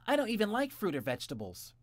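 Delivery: put-on high, loud whiny child; whiny boy. A narrator's reading. Jimmy protests eating vegetables. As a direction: protest like a picky kid.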